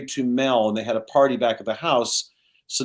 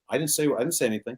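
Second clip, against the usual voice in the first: softer, quicker mock-innocent denial; feigned innocence; 5 dB quieter, faster than usual. [name] denying to Lucy, soft and fast. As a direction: rattle off an innocent denial, hushed.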